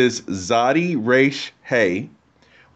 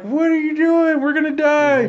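much higher, frantic cry of doomed men; mock panicked disciples. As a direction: play the terrified disciples, voice shooting up in panic. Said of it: "the disciples are freaking out".

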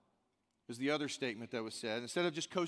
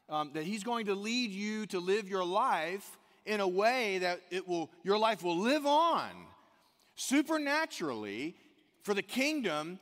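loud, high, slowed pulpit preaching; preaching at full force; 6 semitones higher, 5 dB louder than usual. Acted. Pastor urging the church to live for Christ, preaching hard. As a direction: drive it home to the congregation. Sermon climax on risk and calling.

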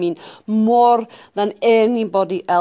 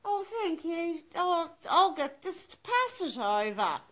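soft, high-pitched aunt; small high voice, away from Olga's; aunt on the phone; 8 semitones higher, 11 dB quieter than usual. Acted. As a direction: play the fussy aunt taking the phone. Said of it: "Aunt Ednit".

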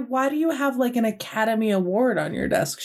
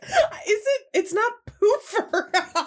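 high laughing through words; 13 semitones higher, slower than usual. Real, not acted. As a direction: crack up while speaking. Laughing while correcting friend.

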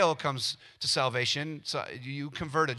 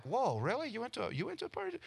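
mock surprise; 8 semitones higher, 6 dB quieter, faster than usual. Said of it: "And they're like".

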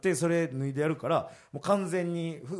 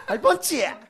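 high-pitched mock Tosa dialect; 9 semitones higher, 4 dB louder than usual. Shown as a demonstration. Comedian repeats his Tosa dialect attempt, high and punchy. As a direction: call it out in exaggerated Tosa dialect. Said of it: "土佐弁の、".

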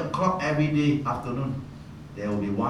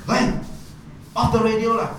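put-on louder, much higher yell at the boy; retold shout; 10 semitones higher, 4 dB louder than usual.

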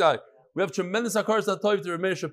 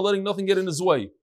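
normal talking voice

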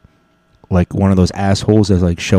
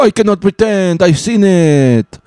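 put-on French accent; 11 semitones higher than usual. French guard refusing to look away, high melodramatic French accent. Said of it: "this guy had a French accent".

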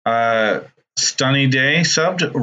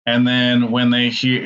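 ordinary delivery

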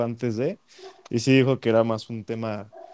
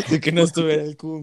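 laughing; 4 semitones higher than usual. Real, not acted. Laughing at a funny remark.

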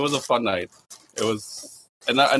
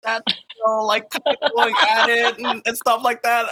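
laughing; 7 semitones higher than usual. Real, not acted. Laughing while telling.

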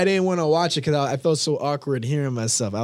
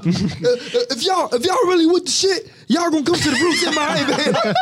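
hype shout; 10 semitones higher than usual. Pretend. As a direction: pump up the crowd. Hyped call to come to the show.

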